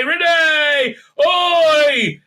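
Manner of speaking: high, loud calling out; mock warning shout. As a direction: yell to someone in a car's path. Acted shout as car comes.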